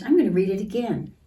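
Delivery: plain reading voice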